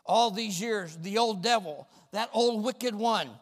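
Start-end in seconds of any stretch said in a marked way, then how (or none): none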